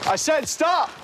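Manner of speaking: shouting commands. Real, not acted. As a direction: yell it to stop someone running. Officer yelling at fleeing suspect.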